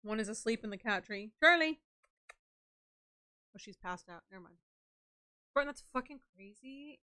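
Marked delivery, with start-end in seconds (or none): 1.4-1.8 calling out. Real, not acted. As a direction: call the cat's name loud. Calling to cat.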